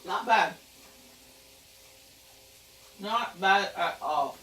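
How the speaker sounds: slow. Shown as a demonstration. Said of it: "tasting, weighing the verdict".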